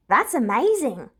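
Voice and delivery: amazed exclamation; exclaiming. A narrator's reading. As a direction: burst out in real wonder. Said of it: "Oboro exclaims".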